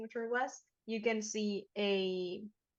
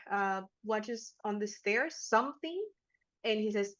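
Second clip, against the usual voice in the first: ordinary talk, nothing marked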